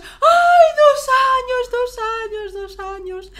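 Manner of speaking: high and loud, fading down; tearful shout. Retold or imitated. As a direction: cry out the age then fade. Retold sobbing reply to the news. (grito lloroso y agudo que se apaga)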